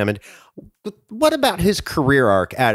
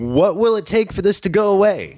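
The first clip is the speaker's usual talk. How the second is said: lightly imitated politician's plea, pitched higher; Alec Baldwin's Trump; 8 semitones higher than usual. Recalling a comedy sketch line for the co-host, lightly imitated. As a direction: quote it with a hint of impression, voice lifted. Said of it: "Alec Baldwin as Trump".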